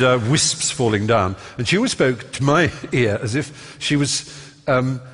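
ordinary talk, nothing marked

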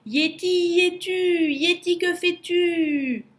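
sing-out holler, pitched well up; calling out. A narrator's reading. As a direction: call up to someone far away, stretching the words. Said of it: "Lou leva la tête et appela".

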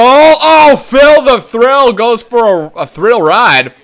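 loud, stretched-out commentator call; excited play-by-play. Pretend. Gamer calling Phil the Thrill's rush, excited. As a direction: draw out the big call, high then falling. Calls a player's move, commentator style.

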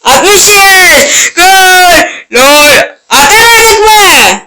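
high, frantic goal yell; screaming. Real, not acted. Gamer unsure if goal went in, high frantic screaming. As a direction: shriek it out high and stretched.

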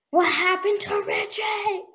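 high, drawn-out mock cry; hammed-up, slow high plea; exaggerated suspense. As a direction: wail the question as if desperate to know. Retold suspense, second take exaggerated.

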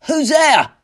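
very high, loud challenge; shouted, alarmed question; startled cry. A narrator's reading. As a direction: cry out into the dark. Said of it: "he cried".

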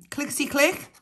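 high sing-song bingo call "Clickety click"; bingo caller. Acted. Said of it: "No, not clickety click".

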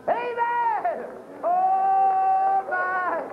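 high, loud, tearful cry of amen; preacher swept up in the spirit, crying out; ecstatic cry. Real, not acted. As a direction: cry out overcome with feeling.

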